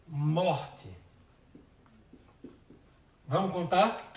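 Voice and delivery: ordinary delivery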